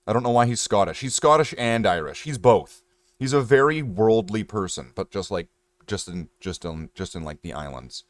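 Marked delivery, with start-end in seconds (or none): none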